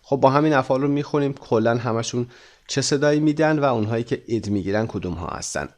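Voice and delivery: ordinary talk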